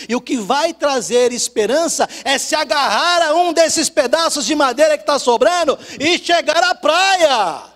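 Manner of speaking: loud, high, rousing sermon cry; rally them to grab the plank and row; preaching at full force. Acted. Preacher rousing the church to hold on to life, loud.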